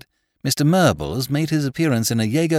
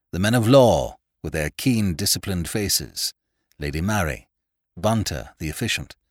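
plain reading voice

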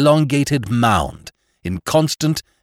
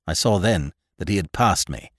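plain reading voice

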